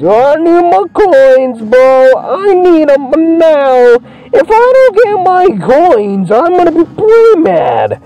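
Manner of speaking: high, drawn-out cartoon pig voice; Ham. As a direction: whine it high and slow like a demanding character. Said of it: "Hopefully Ham gets all happy".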